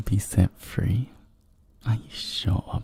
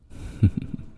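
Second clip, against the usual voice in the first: brief teasing laugh; short chuckle. Acted. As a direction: chuckle knowingly after indulging a request. After granting listeners' request.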